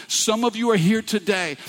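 ordinary delivery

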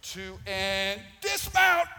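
big drawn-out shout of dismount; loud call. Real, not acted. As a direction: call out the jump slowly and loudly. Jumping off the swing.

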